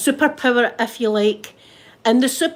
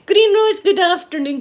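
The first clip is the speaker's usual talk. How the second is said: cheery greeting; 9 semitones higher, 5 dB louder than usual. Real, not acted. Greeting a viewer by name.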